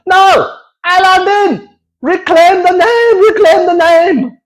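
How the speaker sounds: high, shouted mock protest chant; rally the crowd to reclaim it; high mock-protest shouting; rallying cry. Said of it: "rallying cry".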